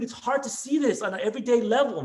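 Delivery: normal talking voice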